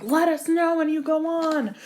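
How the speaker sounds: imitating excited friends. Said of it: "everybody's like".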